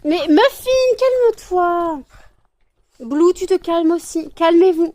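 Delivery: high loud pleading with the animals; loud scolding. Real, not acted. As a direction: call each pet to order loudly. Scolding fighting pets.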